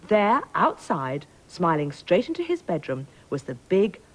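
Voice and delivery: usual speaking voice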